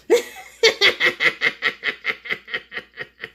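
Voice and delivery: rapid staccato burst of laughter; long cackling laugh. Real, not acted. Painter amused by her neighbors joke, long laugh. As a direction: crack up at your own joke.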